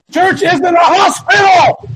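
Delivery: high, slow, emphatic shout; impassioned shout. Real, not acted. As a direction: bellow it as the climax of a rant. Climax of impassioned rebuke.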